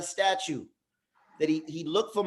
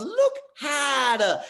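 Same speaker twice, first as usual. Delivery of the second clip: slow, higher, loud Rafiki; 7 semitones higher, 3 dB louder, much slower than usual. Retold or imitated. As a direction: draw it out as the wise old monkey. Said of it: "so he goes".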